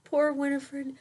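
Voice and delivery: put-on lilting rhyme read to a child; read-aloud storytelling. A narrator's reading. Parent reading a rhyming bedtime book aloud to a child. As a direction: read the verse with a gentle bounce.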